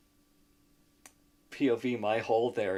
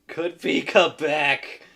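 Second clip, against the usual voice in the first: loud, slow, drawn-out mock exclamation; mocking chat; 5 semitones higher, 13 dB louder, slower than usual. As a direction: belt the chat message out like a taunt. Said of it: "my own chat asking".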